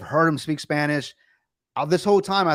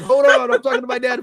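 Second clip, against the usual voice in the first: high, loud mock outburst; exasperated retort; 10 semitones higher, 5 dB louder than usual. Son to phone agent while arguing with his dad, exasperated. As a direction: fend off the interruption, voice shooting up. Snapping at agent mid-argument.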